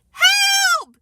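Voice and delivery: very high, loud yell for help; Fudge. A narrator's reading. Fudge about to be destroyed by Peter, crying out. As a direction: scream out for rescue. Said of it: "Fudge cried".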